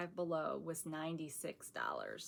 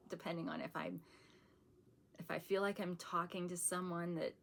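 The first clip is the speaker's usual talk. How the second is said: ordinary talk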